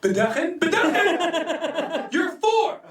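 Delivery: slow, high, laughing disbelief; disbelieving laugh at a four-year-old driver; incredulous. Retold or imitated. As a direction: stretch the word out, stunned. Incredulous at child taxi driver.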